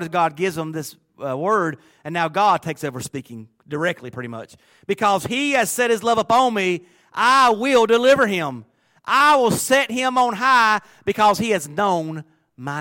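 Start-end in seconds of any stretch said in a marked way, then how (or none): 4.9-12.8 slow, loud, high proclamation of God's promise; preaching at full force. Acted. Preacher reading God's promise to the church, booming. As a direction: call it out like God himself speaking. God takes over speaking.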